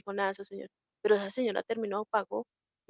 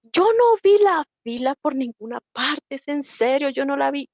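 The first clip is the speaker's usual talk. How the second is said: quoted lady; 4 semitones higher, 8 dB louder than usual. Retold or imitated. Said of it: "una señora me contaba y me decía".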